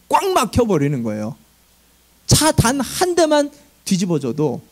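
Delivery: ordinary delivery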